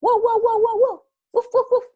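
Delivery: high, loud yapping; dog barking. Acted. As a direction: imitate a small dog's repeated barks. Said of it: "qué pet será". (ladridos agudos y fuertes de perro)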